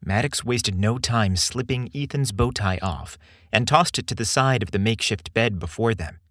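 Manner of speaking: usual speaking voice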